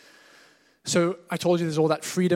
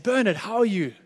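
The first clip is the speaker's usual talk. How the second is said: cheerful greeting; 6 semitones higher, 5 dB louder than usual. Retold or imitated. Pastor greets passenger in car.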